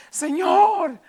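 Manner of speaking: loud, high plea to the Lord; crying out to God. Acted. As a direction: cry out with the whole heart, drawn out. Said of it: "no clames a Dios con todo tu corazón". (clamor a Dios a voz en cuello)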